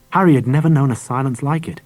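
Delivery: plain reading voice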